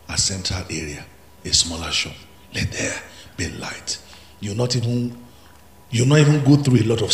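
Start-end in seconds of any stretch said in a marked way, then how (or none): 2.5-4.0 whispered, softer; hushed proclamation. Acted. Preacher whispering the verse to the congregation, hushed. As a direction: breathe it out as a whisper.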